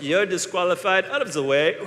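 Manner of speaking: clipped dismissal from the starter; a hint of a stern race official. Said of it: "Victoria will stand out in front of you and go".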